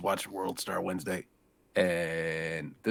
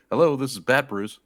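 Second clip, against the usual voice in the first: higher, louder Batman answering phone; 6 semitones higher, 8 dB louder than usual. Retold or imitated. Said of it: "and he goes".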